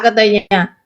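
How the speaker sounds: normal talking voice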